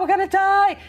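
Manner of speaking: high, loud mock-terrified scream; panicked cry. Acting her gut reaction to cockroaches, panicked scream. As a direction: shriek it in comic panic. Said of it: "my gut was to be".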